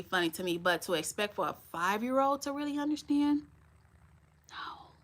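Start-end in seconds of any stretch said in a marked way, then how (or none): 4.5-4.9 a breathy whispered no, almost silent. Real, not acted. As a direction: drop to a bare whisper. Quiet aside after a question.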